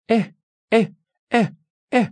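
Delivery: high, short bird squawks; bird noise. A narrator's reading. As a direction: imitate a bird calling in little bursts. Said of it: "a good noise for birds to make".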